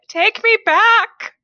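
high, hammed-up pleading cry; mock heartbroken lover. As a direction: ham up a dramatic K-drama lover begging. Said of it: "A dude just being like".

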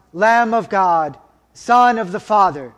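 ordinary talk

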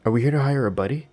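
high, loud, quick cartoon salesman; high-pitched cartoon man. A narrator's reading. Cartoon man asks a caller about hiring a buddy, high and chirpy. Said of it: "his voice just as high-pitched and cartoon-like".

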